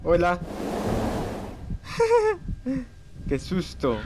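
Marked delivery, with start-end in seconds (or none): 0.4-2.8 high, airy laugh after a fright; breathy nervous laugh. Real, not acted. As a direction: giggle away the scare. Risa tras el susto. (risa nerviosa susurrada)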